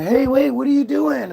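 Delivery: high-pitched mock questioning; imitated concerned mom. Another mom stopping dad as he leaves, imitated concern.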